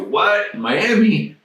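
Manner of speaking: high, fast disbelieving cry; can't believe what you just heard; teen stunned at news, surprised; astonished. Retold or imitated. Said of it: "I go".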